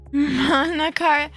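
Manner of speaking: ordinary delivery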